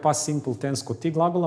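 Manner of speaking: ordinary delivery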